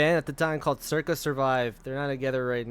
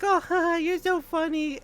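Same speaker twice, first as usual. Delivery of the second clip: mock giggly associate; 14 semitones higher than usual. Mimicking the associates who laugh off Everett's fuck off, high and giggly. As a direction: squeal it out like someone laughing off an insult.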